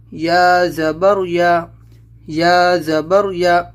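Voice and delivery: slow, syllable by syllable spelling out each letter with zabar; slow, careful Qaida recitation for a child; slow spelling drill. Shown as a demonstration. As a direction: recite it slowly like a teacher drilling. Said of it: "تو آپ سمجھ کے آواز کیسے نکلے گی زبر کی".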